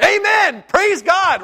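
very high, loud mock amen; joyful pretend shout from the pews. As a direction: call it out like a fired-up congregation. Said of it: "we should be going".

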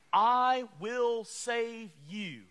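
very slow, weighty "I will save you"; slow solemn promise. Acted. Preacher voicing God's promise, very slow.

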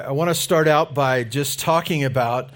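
booming through a hot mic; at a pulpit microphone. Real, not acted. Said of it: "this is a little hot up here".

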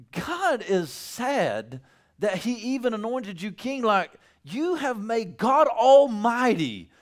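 voicing Samuel. Samuel scolds Saul for disappointing God, slow and emphatic. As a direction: lay down the verdict slowly.

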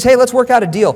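high, loud, breezy deal-making; mock-friendly bargaining; chipper. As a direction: pitch it like a cheery wheeler-dealer. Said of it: "He's all chipper".

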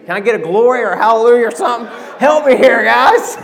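preacher laughing and calling the congregation to shout back, loud; fired-up plea. Real, not acted. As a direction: whip the crowd up for a hallelujah. Preacher begging congregation for response.

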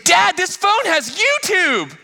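high, slow, thrilled cry; excited daughter. Retold or imitated. Daughter bursting into dad's room with news. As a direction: squeal it like a delighted kid. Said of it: "she goes".

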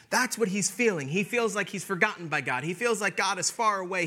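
ordinary talk

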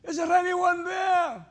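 high, loud, slow call for help; desperate shout. Pretend. Man hanging from a branch, desperate shout. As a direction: shout up the cliff in a panic. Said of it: "following conversation ensued".

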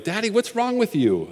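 put-on high, soft child's voice; little son's voice. Pastor imitating his son asking why Daddy is crying, high and soft. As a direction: pipe it up like a small boy. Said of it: "my son Mike is like".

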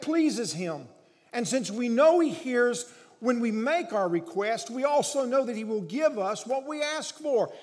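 emphatic pulpit delivery at full volume; preach. Real, not acted. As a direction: preach with force and conviction, hammering key words.

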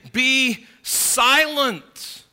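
very slow, loud, high command to hush; stern rebuke. Acted. Crowd silencing the blind beggar, stern slow rebuke. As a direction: bark it down at the beggar, dragging each word. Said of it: "it's a voice of rebuke".

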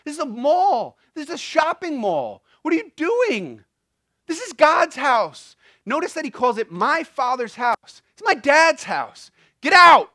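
preacher acting out an outraged Jesus; indignant Jesus. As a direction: voice Jesus throwing them out, building to a shout. Preacher voices Jesus clearing temple.

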